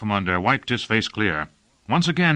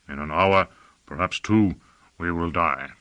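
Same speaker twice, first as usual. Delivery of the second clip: lower, lightly voiced grave elder; grave Hein Huss; 5 semitones lower than usual. A narrator's reading. Hein Huss tells his apprentice they will die, low and grave. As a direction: pitch it down, resigned and measured. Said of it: "said Hein Huss".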